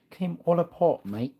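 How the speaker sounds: high mock British "came all apart, mate". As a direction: toss in a cheeky British mate.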